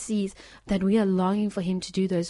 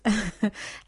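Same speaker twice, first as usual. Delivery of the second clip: a short glad chuckle; happy laugh; 2 semitones higher than usual. Real, not acted. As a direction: let the joy spill out in a laugh. Laughs after "doesn't have aids.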